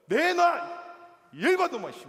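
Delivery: retold furious outburst at the clerk; angry shout. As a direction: bark it at the clerk, enraged. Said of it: "엄청 화를 냈어요".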